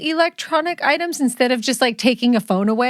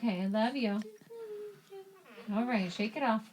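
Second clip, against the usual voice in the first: soft reassurance; 8 dB quieter, faster than usual. Real, not acted. Soothing daughter, softly.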